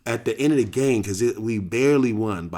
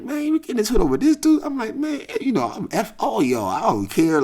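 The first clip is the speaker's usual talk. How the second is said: defiant; 13 semitones higher, 4 dB louder than usual. Retold or imitated.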